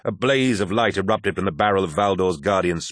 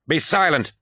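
high, sharp commanding shout; shouted order; 8 semitones higher than usual. A narrator's reading. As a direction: silence him with a shout. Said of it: "shouted Valdor".

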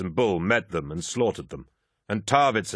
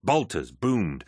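usual speaking voice

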